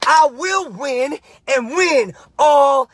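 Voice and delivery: slow, emphatic declaration with stretched key words; passionate, intense mantra. Shown as a demonstration. Coach demonstrating the win all day mantra for repeating, passionate and intense. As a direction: declare it with full intensity, pausing between phrases. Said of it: "I say it with passion. I say it with enthusiasm. I say it with intensity".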